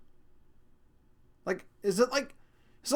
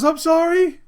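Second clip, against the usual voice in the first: loud, high mock child apology; imitated daughter; 10 semitones higher, 12 dB louder than usual. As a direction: imitate a little kid's apology, pitched way up. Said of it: "She goes".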